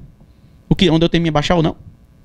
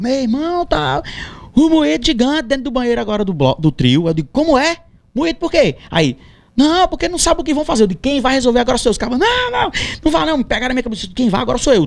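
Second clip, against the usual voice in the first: high-pitched, urgent back-and-forth; agitated retold argument; 6 semitones higher than usual. Band boy reports trouble in the trio bathroom, agitated. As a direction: re-enact a heated flurry of voices, pitched up.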